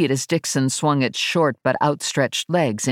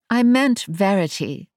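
higher-pitched Englishwoman's voice; English accent; 6 semitones higher than usual. A narrator's reading. Ash introduces herself to Verity, English accent. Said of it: "a woman with an English accent".

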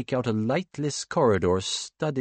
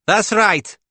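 raised, defiant woman's voice; fierce accented woman; 12 semitones higher, 9 dB louder than usual. A narrator's reading. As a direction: throw it back defiantly. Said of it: "fierce and heavily accented".

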